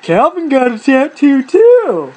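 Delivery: slow, high, loud mocking singsong; gleeful teasing. Sister laughing at her pouting boy's tattoo, gleeful teasing. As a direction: stretch it out to tease, imitating a tickled sister. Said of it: "Mandy is laughing her ass off".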